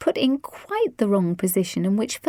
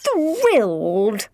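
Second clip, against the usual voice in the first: louder, gushing mother; trilled delight; 5 semitones higher, 4 dB louder, slower than usual. A narrator's reading. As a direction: gush with delight. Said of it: "Lizzie's mother trilled".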